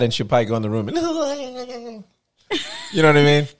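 ordinary delivery

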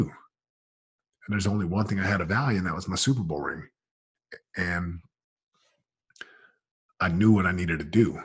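ordinary talk

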